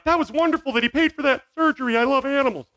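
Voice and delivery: high-pitched mock sweetness; hammed-up adoring praise; gushing animal lover. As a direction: gush in a put-on animal-lover voice.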